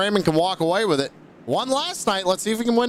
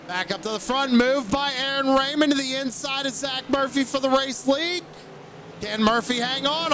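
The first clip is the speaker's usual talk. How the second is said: higher, urgent live race call; excited race play-by-play; 5 semitones higher, 3 dB quieter than usual. Real, not acted. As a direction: call the lead battle as it unfolds, pitch lifted. Calls live battle for lead.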